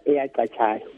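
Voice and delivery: usual speaking voice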